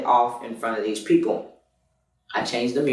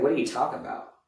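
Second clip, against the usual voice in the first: stunned quiet; 7 dB quieter, much faster than usual. Retold or imitated. Stunned by her confession.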